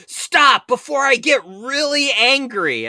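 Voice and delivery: high, loud, drawn-out angry yell; retold scream. Angry passenger threatens the bus driver, screaming. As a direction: scream the threat, stretching the words. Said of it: "he screams".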